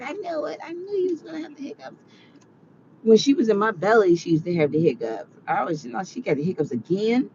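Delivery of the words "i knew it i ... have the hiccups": high, fast, quiet I-knew-it; told you so. Retold or imitated. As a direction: rattle off, smug parent. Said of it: "I was like".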